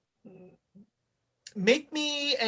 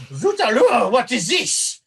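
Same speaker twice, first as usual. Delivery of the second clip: put-on louder French-accented chef, thrown off; startled French chef; 3 semitones higher, 5 dB louder than usual. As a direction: play an outraged French kitchen chef. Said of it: "there's a chef".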